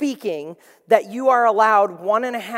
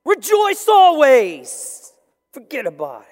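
louder, higher New York Italian "all yous", slow "rejoice"; hammed-up Italian-American street voice; Italian-American accent; 5 semitones higher, 8 dB louder, slower than usual. Preacher mimicking Italians addressing everybody, hammed-up Italian-American accent. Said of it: "how the Italians say".

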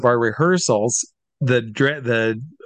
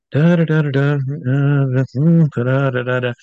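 bouncy la-di-da humming, starts louder; innocent humming; 3 dB louder than usual. Retold or imitated. Caught glancing during changes, humming innocently. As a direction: hum to cover an awkward glance. Pretending not to look.

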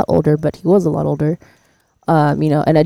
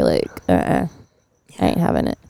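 low sassy refusal; 11 semitones lower, 6 dB quieter than usual. Real, not acted. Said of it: "firm refusal".

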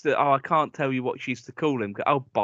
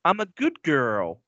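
put-on slow, drawn-out American girl; American accent; slower than usual. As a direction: imitate a child's put-on American accent, slowly. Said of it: "like an American accent".

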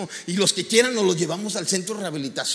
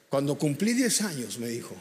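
normal talking voice

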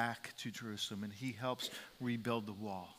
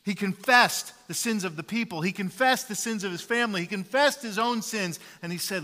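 loud, high, fervent preaching; a full-voiced sermon climax; preaching at full force; 6 semitones higher, 10 dB louder than usual. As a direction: proclaim it from the pulpit. Preacher retells Nehemiah's prayer.

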